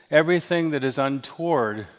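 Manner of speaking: ordinary talk, nothing marked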